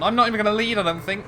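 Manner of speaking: sudden high, loud outburst; surprised. Real, not acted. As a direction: blurt it out in alarm. Realises he won't lead the race.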